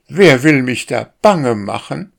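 the starling flusters at the warning, a bit startled; a touch of alarm. A narrator's reading. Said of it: "fragte der Star und flatterte unruhig".

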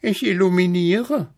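high-pitched little cat voice; rufende Katze. A narrator's reading. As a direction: call it down from the treetop. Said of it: "rief die kleine Katze herunter". (hoch herunterrufendes Kätzchen)

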